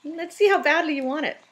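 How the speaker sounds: high, loud teasing coo. Real, not acted. Teasing the kitten with the bottle, high playful coo. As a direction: dangle the bottle in a high sing-song.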